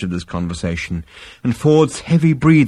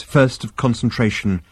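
plain reading voice

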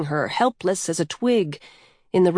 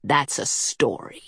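low, restrained snarl; 5 semitones lower, 4 dB quieter than usual. A narrator's reading. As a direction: dismiss it with a low growl of annoyance. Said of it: "Coyote snarled".

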